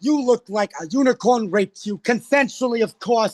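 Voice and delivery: Joan Rivers impression. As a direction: rattle off a mock read, honey first. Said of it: "Joan was like".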